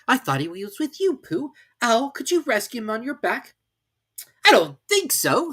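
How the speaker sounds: put-on high, eager boy's voice; an excited little boy; Christopher Robin voice. A narrator's reading.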